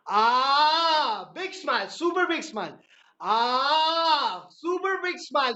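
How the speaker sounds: loud, high, stretched super big smile; laughter yoga shout. Shown as a demonstration. Instructor leading the group in laughter yoga, loud and high. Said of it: "let's start with a big smile".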